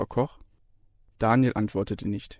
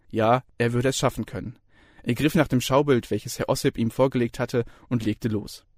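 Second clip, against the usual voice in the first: usual speaking voice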